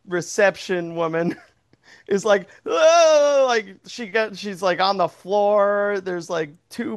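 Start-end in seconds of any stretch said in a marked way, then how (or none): 2.7-3.4 shocked gasp. Retold or imitated. Acted shocked reception woman.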